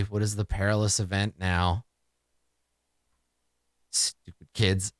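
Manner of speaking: ordinary delivery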